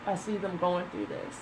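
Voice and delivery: soft, fading, tearful voice; quietly sad. Real, not acted. Reader seeing a friend in the cards, soft and tearful. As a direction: sad for someone she sees in the cards. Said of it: "And it makes me sad".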